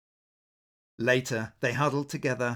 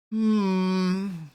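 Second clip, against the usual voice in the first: high, louder musing hmm; thoughtful sigh; 6 semitones higher, 5 dB louder than usual. Acted. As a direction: sigh while weighing it up. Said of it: "sighed the blue".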